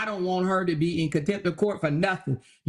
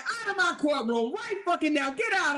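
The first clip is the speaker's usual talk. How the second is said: mock judge ejecting; 5 semitones higher than usual. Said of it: "Your Honor be like".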